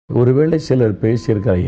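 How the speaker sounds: ordinary delivery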